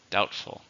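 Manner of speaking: "Doubtful" with the stress on DOUBT; stress on first syllable. Shown as a demonstration. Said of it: "stresses on the first".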